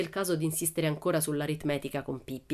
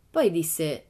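plain reading voice

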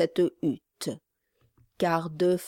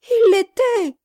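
much higher, louder tearful cry; 9 dB louder than usual. A narrator's reading. Mother cries out to the gentleman, high tearful shout. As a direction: cry it out through tears. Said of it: "s'écria la femme".

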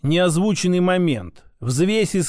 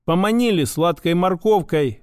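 ordinary delivery, nothing marked

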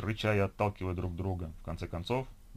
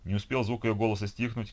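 ordinary delivery

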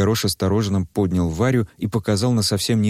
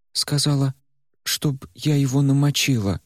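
plain reading voice

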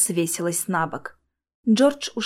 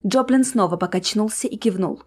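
usual speaking voice

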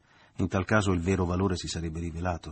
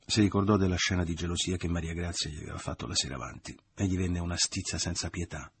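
ordinary delivery, nothing marked

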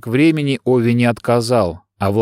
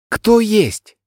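calling out; 11 semitones higher than usual. A narrator's reading. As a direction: call out anxiously into the empty home. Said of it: "крикнула". (громкий оклик)

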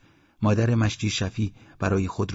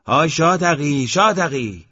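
high, raised hail, repeated; loud call back; 7 semitones higher, 6 dB louder than usual. A narrator's reading. Eslam calls back to the man on the porch, loudly. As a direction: answer a distant caller at full voice. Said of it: "اسلام با صدای بلند گفت".

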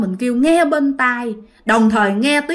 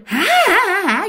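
high mocking demon laugh; 8 semitones higher than usual. The demon laughing at Thi, high mocking cackle. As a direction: cackle at her like a taunting ghost. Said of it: "Nó cười".

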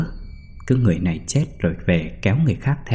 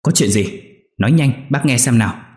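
louder, rising impatient snap; old man snaps at the crying niece on the phone; snapping irritably; 5 semitones higher, 4 dB louder than usual. Acted. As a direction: cut her off, demand answers. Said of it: "Ông Toàn gắt lên".

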